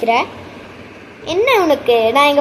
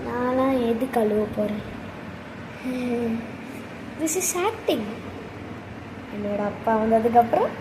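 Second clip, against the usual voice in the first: softer, lower voice sharing a secret plan; hushed scheming; 6 semitones lower, 11 dB quieter than usual. Acted. Student telling friend her plan, hushed. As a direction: plot quietly with a friend.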